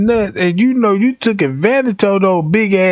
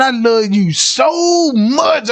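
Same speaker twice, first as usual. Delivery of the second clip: swooning fan; 5 semitones higher, much slower than usual. Retold or imitated. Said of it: "she was like".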